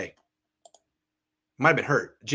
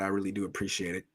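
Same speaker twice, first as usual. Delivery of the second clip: ordinary talk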